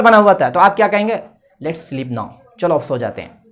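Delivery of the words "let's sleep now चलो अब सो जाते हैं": soft, drowsy goodnight suggestion; sleepy bedtime hush. Shown as a demonstration. To friends after a night story, a softer sleepy suggestion. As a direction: ease everyone toward sleep, much softer.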